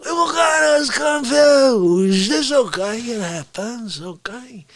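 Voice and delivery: high, drawn-out hammy character voice; cocky Fauci-style character. Acted. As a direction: stretch the words like an exasperated blowhard.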